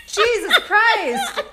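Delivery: drawn-out, slow words through laughter; exasperated, amused, slowed; laughing exasperation. Real, not acted. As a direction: groan it out while cracking up.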